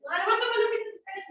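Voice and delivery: dismissive nurse mockery; rude nurse. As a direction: imitate a curt nurse brushing off a patient. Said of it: "nada más llegas y".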